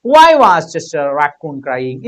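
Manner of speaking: slow, drawn-out reading of the question. Shown as a demonstration. Teacher reading the exam question slowly to students. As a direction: read each word slowly for the class. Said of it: "ഇനി നിങ്ങളോട് ചോദിക്കുന്ന ഒരു question".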